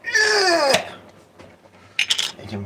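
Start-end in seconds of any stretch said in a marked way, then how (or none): 0.0-1.0 high, loud happy yelp; excited cheer. Real, not acted. Cheering over pre-made sauce.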